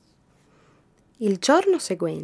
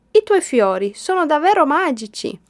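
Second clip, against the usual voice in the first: beaming smile; 8 semitones higher, 7 dB louder than usual. A narrator's reading. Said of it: "disse con un sorriso raggiante".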